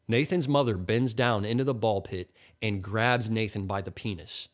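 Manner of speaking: plain reading voice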